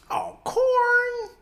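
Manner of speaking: high drawn-out "Oh, corn"; mock slang call-out. Reminding a friend of old slang, high drawn-out jeer. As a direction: tease with a high, stretched jeer.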